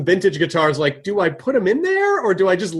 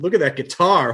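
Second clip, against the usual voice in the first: usual speaking voice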